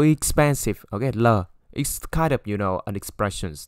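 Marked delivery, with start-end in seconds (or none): none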